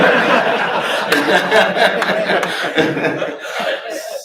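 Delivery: loud, high laugh that trails off; long laugh. Real, not acted. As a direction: crack up at your own punchline. Cracks up at own punchline.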